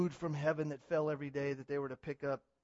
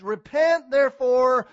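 loud, fiery call to repent; shouted repent; 8 semitones higher, 17 dB louder, slower than usual. Acted. Pastor commanding his church to repent, shouting. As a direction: throw the word at the whole congregation.

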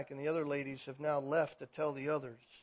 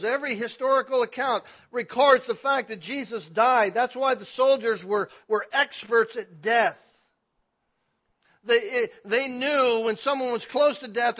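loud, driving pulpit voice; louder, higher preaching push; full-force preaching; 7 semitones higher, 11 dB louder than usual. Acted. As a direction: preach it out to the back pews.

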